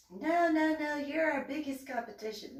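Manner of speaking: high put-on protest; imitated insistent contestant. Voicing contestants insisting she competes.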